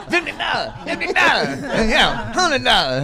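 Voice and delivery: high squeals of laughter through the words; can barely get the words out for laughing. Real, not acted. Host cracks up at the fifty-dollar fine, laughing high.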